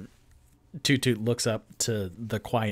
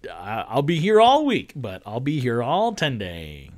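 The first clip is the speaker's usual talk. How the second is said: boastful; 3 semitones higher, 7 dB louder than usual. Acted. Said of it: "Three Strings goes".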